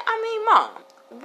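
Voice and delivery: a hint of a son explaining the obvious; lightly voiced child. Son reminding mom about candy canes, lightly voiced high and slow. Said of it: "He said".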